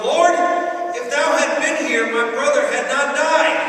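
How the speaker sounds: loud, high plea to the Lord; pleading cry. Acted. Mary calling out to Jesus in her grief. Preacher voices Mary's plea.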